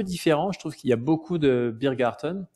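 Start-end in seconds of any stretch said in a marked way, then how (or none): 1.8-2.5 Biergarten in a put-on German accent. Shown as a demonstration. Podcaster showing off beer gardens to listeners, best German accent. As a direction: give the word your best German accent. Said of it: "j'ai pris mon meilleur accent allemand".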